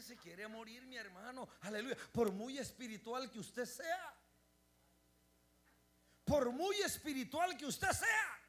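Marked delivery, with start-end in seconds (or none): 6.2-8.4 slow, raised, emphatic repeat; preacher repeating for emphasis, louder. Shown as a demonstration. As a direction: lift it up louder the second time. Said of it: "repeated louder".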